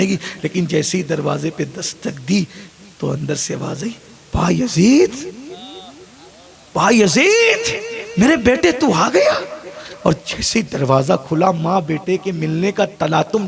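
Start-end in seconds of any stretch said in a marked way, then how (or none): 4.3-5.3 a mother's raised call of her son's name. Acted. Old mother calls her long-lost son through the door, calling out. 6.7-7.7 high, slow, stretched call of the name; long drawn-out call. Acted. Mother calls Bayazid again through the closed door, long drawn-out high call. 8.2-9.3 joyful cry. Acted.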